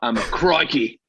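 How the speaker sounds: hammed-up Australian catchphrase voice; mock Australian accent. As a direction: play up a cartoon Australian accent. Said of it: "that's definitely Australian".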